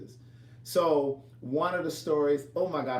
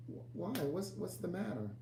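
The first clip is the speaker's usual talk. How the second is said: soft, higher, halting question; retold gentle asking, much quieter; hushed concern; 5 semitones higher, 9 dB quieter than usual. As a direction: ask carefully, stumbling over the first words. Concerned question to tearful friend.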